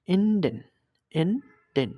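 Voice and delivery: "এন্ডেন" loud, opening on a clear "e"; German E as "e". Shown as a demonstration. Teacher modelling German E for learners, "এন্ডেন" begun with "e". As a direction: say "এন্ডেন" with E as in "end", slightly drawn out. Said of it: "এর মতন উচ্চারণ করে".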